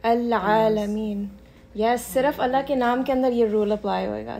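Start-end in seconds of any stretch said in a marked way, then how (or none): 0.1-1.3 "अल आलमीन" very slow with a light lam. Shown as a demonstration. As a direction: stretch "अल आलमीन" gently, lam kept thin. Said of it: "light पढ़ेंगे". ("अल आलमीन" धीमे, हल्का लाम)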